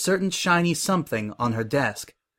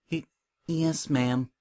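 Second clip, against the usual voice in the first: small, stammered, softer reply; nervous, meek; 2 semitones higher, 5 dB quieter, slower than usual. A narrator's reading. Coco answering Prim's question about being forced, nervous and meek. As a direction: answer meekly, tripping on the first word. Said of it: "swallowed nervously and meekly replied".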